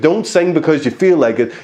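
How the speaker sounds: ordinary delivery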